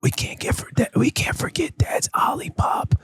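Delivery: put-on hushed child whisper to mom. Imitated daughter whispering the drink order to mom. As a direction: whisper the message conspiratorially.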